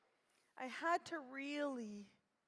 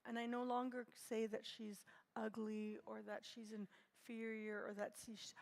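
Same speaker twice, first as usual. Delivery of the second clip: ordinary talk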